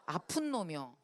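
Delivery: ordinary talk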